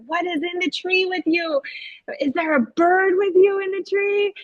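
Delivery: high, singsong curious question; teacher to child. Retold or imitated. Teacher interviewing the boy's puppet about its tree, high singsong.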